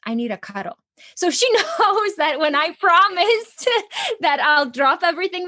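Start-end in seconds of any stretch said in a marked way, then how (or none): 0.0-0.8 put-on quick soft child plea. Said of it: "So now whenever I say".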